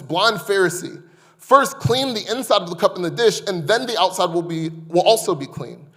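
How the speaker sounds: loud, rising preaching of the woes; fiery scripture reading; forceful scripture reading. Acted. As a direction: proclaim the woes like a prophet.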